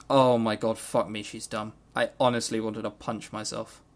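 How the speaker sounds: ordinary delivery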